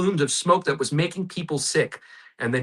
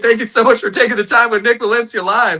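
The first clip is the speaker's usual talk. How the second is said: higher, louder, laughing redo; excited; 7 semitones higher, 6 dB louder than usual. Real, not acted. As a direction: beam the thanks out with delight. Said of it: "you got me back in the day".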